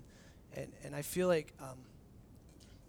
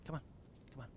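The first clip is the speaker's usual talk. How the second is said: hushed; 5 semitones lower, 14 dB quieter, faster than usual. Retold or imitated. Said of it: "he's like".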